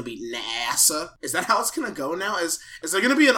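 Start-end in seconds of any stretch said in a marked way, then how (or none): 0.0-1.1 "nasa" with a drawn-out, doubled S; extra S in NASA. Shown as a demonstration. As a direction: stretch out the middle of "nasa". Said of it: "put an extra S".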